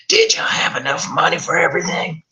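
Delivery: put-on high, breathy old woman; whispery old mother. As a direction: rasp it like a frail mother.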